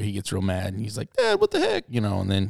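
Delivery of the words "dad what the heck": put-on indignant older brother. Angry older brother confronts dad.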